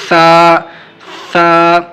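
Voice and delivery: سا with tongue tip between the teeth, lisped; letter demo. Shown as a demonstration. Quran teacher demonstrating سا, tongue between teeth. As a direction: hiss سا through the teeth. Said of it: "سا کے نکالنے کا طریقہ".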